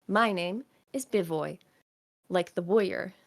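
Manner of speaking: lightly voiced proud boy; proud little kid. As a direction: say your name like a small hero. GM voices kid naming himself.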